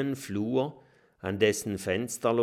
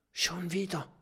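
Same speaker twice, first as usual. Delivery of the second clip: frightened high whisper; scared whisper in a dark castle; 9 semitones higher, 3 dB quieter than usual. A narrator's reading. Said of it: "flüstert Mathilda".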